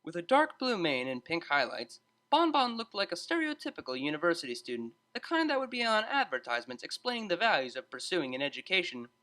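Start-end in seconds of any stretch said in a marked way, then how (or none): none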